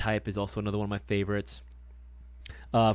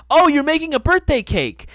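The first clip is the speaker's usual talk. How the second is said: much higher and louder blurted announcement; 13 dB louder than usual. Retold or imitated. Friend spoiling the surprise cake out loud, a high loud blurt. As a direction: blurt it out, oblivious to the secret. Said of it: "and then out loud, he goes".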